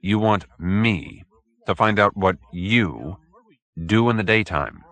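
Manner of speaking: slow and measured, weighing each word; said slowly. Acted. Detective repeating the odd request back, slow and deliberate. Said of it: "then said slowly".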